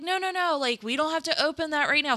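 head off the gift in a rush; panicked refusal. Retold or imitated. Dad blocking the present, a mock panicked no.

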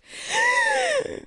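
big high dramatic gasp; hammed-up sharp intake of breath; large gasp. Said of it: "let out a large".

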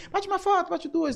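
squealing high and loud; excited fan. Retold or imitated. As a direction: shriek it like a starstruck fan. Excited fan asking for photos.

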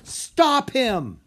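high, loud order; commanding yell. A narrator's reading. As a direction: command the dragon with a shout. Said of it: "she yelled".

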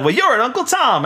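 high-pitched imitated retort; mock accusation. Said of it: "turns it around on him".

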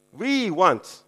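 "We" punched high and loud; stress on WE. Acted. Disciples asking Jesus for top seats, stress on "We". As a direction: lean hard and long on "We". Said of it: "I put the emphasis on the we".

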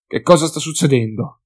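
shouted question over the din; shouting. Acted. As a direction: yell to be heard through chaos. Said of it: "urlò nel frastuono delle grida".

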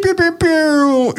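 beeping. Shown as a demonstration. DJ soundboard effect imitated.

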